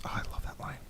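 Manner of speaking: low, hushed literary reading; dramatic reading. Shown as a demonstration. Host reading a favorite Alan Moore passage, hushed. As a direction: read it like a reverent passage. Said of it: "I wanted to read one of my favorite lines".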